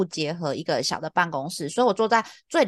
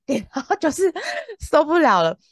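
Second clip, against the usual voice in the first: laughing; 8 semitones higher, 4 dB louder than usual. Real, not acted. Wrapping up complaint.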